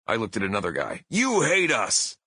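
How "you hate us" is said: high, stretched-out heel accusation; pro wrestler bellow. Retold or imitated. Singer accusing another guy in the crowd, wrestler bellow. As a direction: hurl it slowly at one heckler. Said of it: "bellowed in my best professional wrestler voice".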